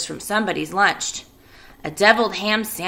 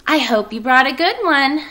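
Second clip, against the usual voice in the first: higher, louder spider voice; Charlotte hopeful; 7 semitones higher, 6 dB louder than usual. Acted. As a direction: ask it hopefully. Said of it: "Charlotte said".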